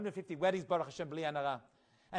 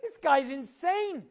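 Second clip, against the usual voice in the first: shocked; 7 semitones higher, 5 dB louder, slower than usual. Retold or imitated. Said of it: "He's like".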